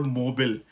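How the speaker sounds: मोबाइल clipped to "mo-bul", no "eye"; American mobile. Shown as a demonstration. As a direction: swallow the end of मोबाइल: "mobul". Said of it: "अब कितना ज़्यादा फ़र्क है".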